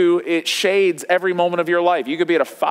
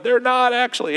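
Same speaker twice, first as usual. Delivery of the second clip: imitated upset child; 6 semitones higher than usual. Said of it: "And he's crying".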